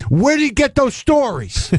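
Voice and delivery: hammed-up old man demanding answers, high and slow; imitating a yell. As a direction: drag out the indignant question. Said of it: "Shecky Greene yelling at me".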